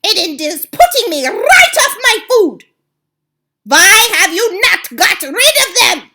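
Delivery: very high, loud, furious screech; the Grand High Witch rages at her coven over the children; screaming witch. Acted. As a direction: shriek with disgust at the children. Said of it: "she screamed".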